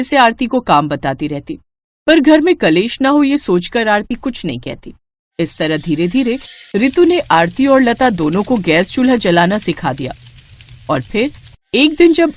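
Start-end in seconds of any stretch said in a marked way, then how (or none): none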